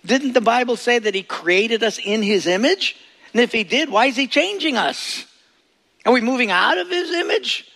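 high, quick puzzled questions; imagined listener's objection. Retold or imitated. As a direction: play a doubting churchgoer. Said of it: "you say".

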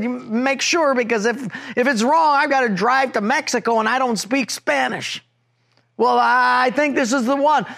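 high, agitated, pressing voice; a frustrated driver losing patience; heated bickering. Retold or imitated. Husband and wife bickering over the wrong exit, high and agitated.

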